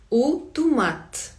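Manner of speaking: "У томат" drawn out as oo too-MAT; slow model pronunciation. Shown as a demonstration. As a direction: stretch Portuguese "У томат". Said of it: "Давайте за мною".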